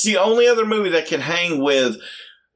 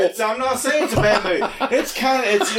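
high-pitched backpedalling through laughter; laughing protest; 7 semitones higher than usual. Real, not acted. Protesting amid laughter over teasing.

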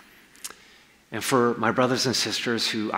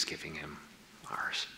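whispered question; 5 semitones lower, 13 dB quieter than usual. Real, not acted. Sermon's intimate closing question.

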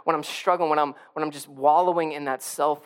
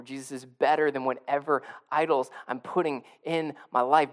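usual speaking voice

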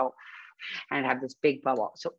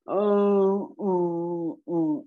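slow drawn-out mopey oh sounds; mopey Eeyore groan; 4 dB louder, much slower than usual. Retold or imitated. Voicing Eeyore for the viewers, mopey groan. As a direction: groan like a sad donkey. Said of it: "Eeyore's described as he walks in, he's always like".

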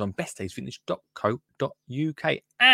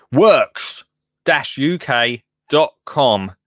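slow URL read; 4 dB louder, much slower than usual. Shown as a demonstration.

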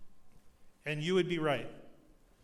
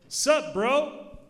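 high, loud "Sup, bro?" called out; kids' greeting; 10 semitones higher, 10 dB louder than usual. Retold or imitated. Kids running up to the visitors, calling out loud and high. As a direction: call it out like eager kids.

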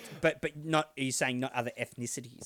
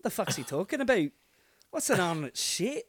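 a hint of a mock Mexican accent, confused; lightly voiced foreign listener, puzzled; 5 semitones higher than usual. Overseas fan puzzled by Aussie biscuits, mild mock accent. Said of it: "borderline offensive Mexican".

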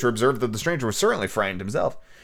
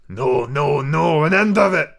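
angry cry; 3 semitones higher, 5 dB louder, slower than usual. Acted. Captain cries out.

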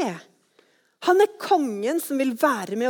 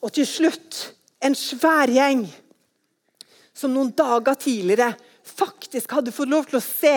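usual speaking voice

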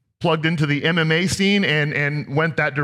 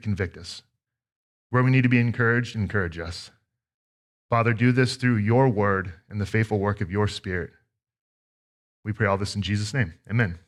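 prayer; 3 semitones lower than usual. Acted. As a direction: pray over the congregation gently. Opening prayer before sermon.

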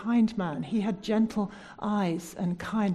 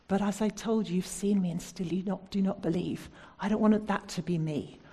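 ordinary delivery

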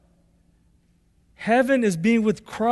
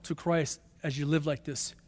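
ordinary talk

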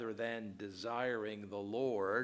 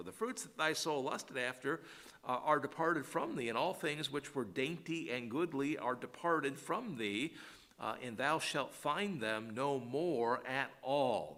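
solemn scripture reading; 4 semitones higher than usual. Acted. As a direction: give the alas lines full weight. Reads scripture aloud.

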